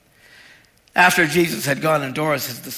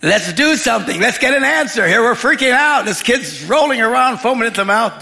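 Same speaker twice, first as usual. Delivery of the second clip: high, loud, urgent freak-out; acted panic; 7 semitones higher, 6 dB louder than usual. Bystander demanding help for a convulsing kid, panicking. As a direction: play someone losing their head in an emergency. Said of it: "Most people would panic".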